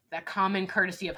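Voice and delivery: ordinary talk, nothing marked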